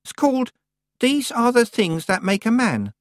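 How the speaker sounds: put-on a child reciting a title; child's voice. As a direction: speak as a small boy. Child character voice.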